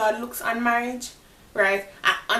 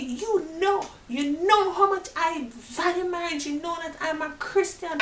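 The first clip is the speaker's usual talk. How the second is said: high, hurt appeal; hurt pleading; 9 semitones higher than usual. Retold or imitated. Pleading with the married boyfriend about her values, high and hurt. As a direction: plead with him as someone betrayed.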